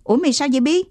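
put-on worried boy's question; alarmed question. A narrator's reading. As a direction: rush up and ask in concern. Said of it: "Thằng Tí liền chạy tới hỏi".

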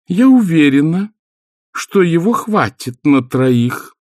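high, slow put-upon wife's voice; long-suffering martyr. A narrator's reading. Wife to husband's guest, long-suffering martyr tone. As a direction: sigh it out like a martyr keeping score. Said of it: "тоном многострадальной мученицы".

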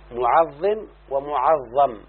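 معظم ومعظم spelled out slowly, each vowel distinct; careful model forms. Shown as a demonstration. Teacher dictating the forms معظم ومعظم to students, slow and deliberate. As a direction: slowly contrast معظم and معظم syllable by syllable. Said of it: "كذلك كلمة عظم يعظم".

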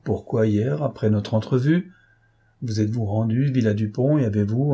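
plain reading voice

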